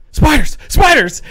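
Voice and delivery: high, loud, stretched-out mock alarm; imitated panic. Husband imitating sleep-talking wife, loud mock panic.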